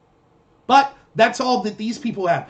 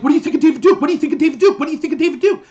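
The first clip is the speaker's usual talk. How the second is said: higher, louder mimicked badgering; mock pestering; 6 semitones higher, 6 dB louder, much faster than usual. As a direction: imitate people hounding with the same question, voice raised. Mimics people pestering with one question.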